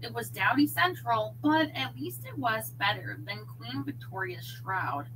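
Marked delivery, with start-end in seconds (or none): none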